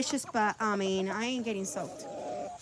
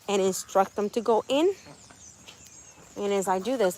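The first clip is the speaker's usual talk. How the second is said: ordinary talk, nothing marked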